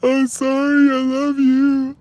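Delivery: high, wailing mock sobs of a guilty girlfriend; imitating her crying. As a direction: whimper the apology way up high, hammed up. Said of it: "She just busts out crying".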